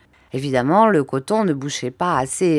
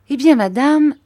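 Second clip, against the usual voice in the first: timid doctor; 9 semitones higher, 8 dB louder than usual. Acted. Doctor regaining courage.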